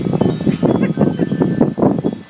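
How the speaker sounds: high squeaky laugh. Real, not acted. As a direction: burst into a giddy laugh. Laughing at the dirt.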